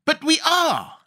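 very high, impatient cry; impatient insistence. A narrator's reading. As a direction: insist like a foot-stamp, pitch shooting up. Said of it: "stamping her foot impatiently".

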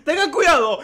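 loud, high alarm; theatrical cry of danger; mock warning shout. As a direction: shout a hammed-up public warning. Reads mock public warning about sect.

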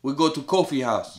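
lightly hammed African accent asking about the cabbage; mock African accent. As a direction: play up a comic African accent for laughs. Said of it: "your African accent you did earlier".

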